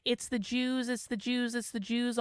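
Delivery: mocking repeated chant; mock drone. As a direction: parrot the slogan dismissively. Mocking the repetitive posters.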